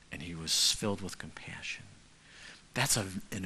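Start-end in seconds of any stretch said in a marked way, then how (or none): 0.1-1.8 breathy whisper, voice dropped; hushed awe; whispered emphasis. Real, not acted. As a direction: whisper it like a secret joy. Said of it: "whispered point".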